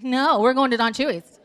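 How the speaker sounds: loud, insistent comeback; retold argument, digging in loudly; irritated. Irritated wife snaps back at her husband, loudly insistent. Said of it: "irritated that I'm irritated".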